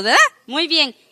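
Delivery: usual speaking voice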